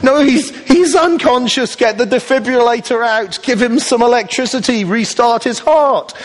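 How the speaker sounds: high, urgent, exasperated pleading; frantic protest. Pretend. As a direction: panic at the absurd paramedic, pitch high. Frantic friend to paramedic.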